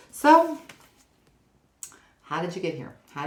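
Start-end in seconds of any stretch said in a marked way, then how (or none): 0.1-0.6 loud so. Real, not acted. Loud exclamation.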